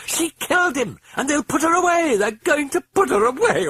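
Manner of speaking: very high, loud hysterical shriek; frantic mother shrieking. Acted. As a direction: shriek it at him. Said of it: "Chris shrieked at him".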